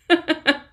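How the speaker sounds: high, loud burst of laughter; loud, high giggling fit; laughing. Real, not acted. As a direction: laugh out loud at your own attempt. Laughs at own French attempt.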